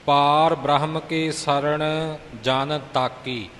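slow, separated words; slow model recitation. Shown as a demonstration. Teacher showing how to recite the next line, slow and careful. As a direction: recite slowly, setting ਜਨ ਤਾਕੀ apart. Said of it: "ਇਉਂ ਤਾਕੀ ਪਾਠ ਕਰਨਾ ਹੈ".

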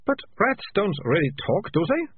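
unsure, almost pleading; bewildered girl. A narrator's reading. Malicia bewildered.

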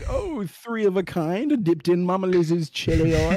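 high, slow, drawn-out bit voice; goofy mock-grand delivery; hammed-up catchphrase. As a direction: stretch it out like a silly announcement. Acting out running chili oil bit.

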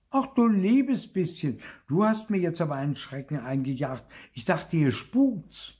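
high, loud cry of fright, easing off; startled exclamation. A narrator's reading. Sister calls out after flying blocks scared her, startled exclamation. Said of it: "rief sie".

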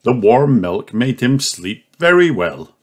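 usual speaking voice

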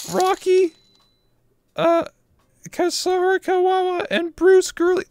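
squeaky cutesy anime voice; cat girl voice. Acted. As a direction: chirp it like a cute cat girl. Cute thank-you voice, ends meowing.

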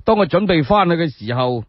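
usual speaking voice

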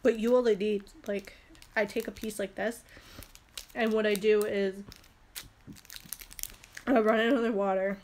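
usual speaking voice

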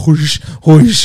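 loud breathy whoosh sounds; whooshing noise. Retold or imitated. Showing his friend the shampoo trick, whooshing sound. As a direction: mimic the bottle sucking in water. Imitating shampoo bottle suction.

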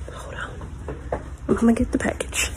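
whispered, nearly no voice; a soft unvoiced aside; hushed whisper. Real, not acted. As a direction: breathe it quietly so no one wakes. Sneaking off for a package.

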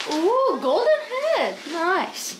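impressed. Real, not acted. Admiring the shower.

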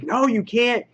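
imitating a pushy heroine barking orders; forceful order. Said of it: "She's so forceful".

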